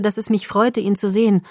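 ordinary delivery, nothing marked

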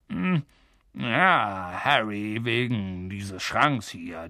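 low, slow, hesitant approach; forced niceness. Acted. Uncle forcing a smile at the boy, low and halting. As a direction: ease into it through a pained smile. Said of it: "zwang dann sein Gesicht zu einem recht schmerzhaft wirkenden Lächeln".

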